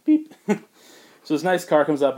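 ordinary talk, nothing marked

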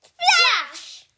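high, loud sound effect; sudden explosive storybook exclamation; exclaimed splash. A narrator's reading. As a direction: shout it like a big splash. Troll hits the river.

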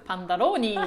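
imitating the kid's mock Spanish パンダローニー, rolled and loud; パンダローニー said as a confident fake Spanish word; fake foreign language. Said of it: "もう発音がシュールすぎて".

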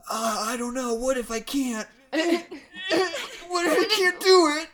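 high, wavering, near tears; whiny scared Ash. Retold or imitated. Mocking Ash doubting he can save the world, high and whiny. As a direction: whimper it out like a scared kid. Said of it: "And Ash is like".

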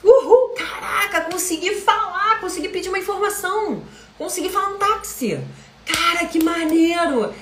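high, excited cheer of a small win; celebrating. Shown as a demonstration. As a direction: whoop it up like you just ordered your first taxi in English. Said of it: "Cara, celebra".

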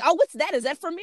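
high-pitched imitation of a curious child; curious daughter. Daughter asking mom about the package, curious and high. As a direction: ask it high, like an inquisitive kid.

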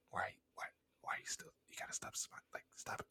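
urgent whisper, imitated; barely audible, voiceless admonishment; covert warning. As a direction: whisper it like a secret scolding. Friend secretly warning him.

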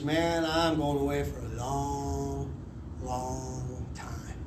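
low, slow, fading dread; glum resignation. Retold or imitated. Hungover prisoner thinking of his sentence, slow glum resignation. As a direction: drag it out quietly, trailing away.

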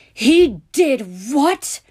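slow, high, very loud bellow; outraged, drawn-out roar; furious shout. A narrator's reading. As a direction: shout it word by word, incredulous. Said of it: "he shouted again".

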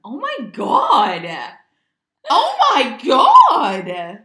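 very loud, high, stretched-out exclamation; ecstatic amazement. Real, not acted. Friend celebrating a manifested vision board, ecstatic shout. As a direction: shout it slowly in real amazement. Amazed wish came true.

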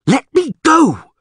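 high, loud, slow scream. A narrator's reading. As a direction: struggle and scream it out. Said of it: "she screamed".

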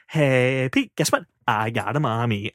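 mock gloat with drawn-out greeting. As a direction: rub it in smugly to a partner. Retold gloating to Pete.

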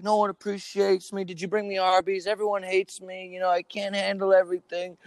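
high-pitched mock whining; imitating upset client. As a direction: rattle off a hammed-up pity-me complaint.

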